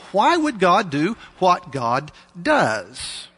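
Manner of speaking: normal talking voice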